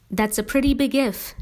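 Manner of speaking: usual speaking voice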